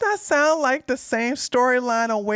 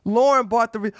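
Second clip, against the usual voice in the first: ordinary talk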